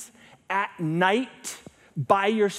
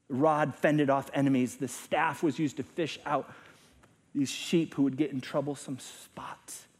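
ordinary talk

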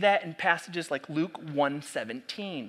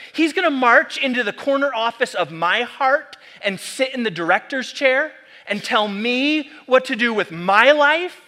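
higher, louder rising protest; indignant protest; 5 semitones higher, 8 dB louder than usual. Retold or imitated. Preacher voicing a listener who resents Jesus' claims, louder. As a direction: build it up as an offended objection. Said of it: "questions like".

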